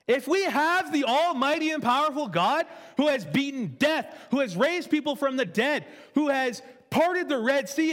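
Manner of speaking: rousing preacher climax; preaching at full force. Acted. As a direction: preach it up to the peak. Building sermon climax.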